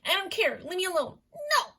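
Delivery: high-pitched mock tantrum; imitating an upset sketch girlfriend, very high; girlfriend in a fight. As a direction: squeal it while being chased. Girlfriend's side of retold sketch fight.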